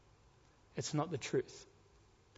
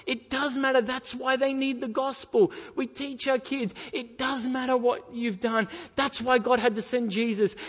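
higher, urgent pulpit delivery; impassioned preaching; 5 semitones higher, 4 dB louder than usual. Real, not acted. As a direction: press each reason on the listeners. Preaches with full force.

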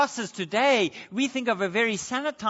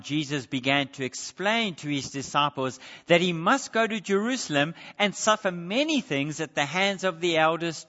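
normal talking voice

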